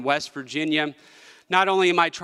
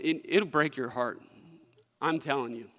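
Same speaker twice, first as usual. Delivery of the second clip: emotional; 3 semitones lower, 7 dB quieter than usual. Real, not acted. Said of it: "sorry to get so emotional about this".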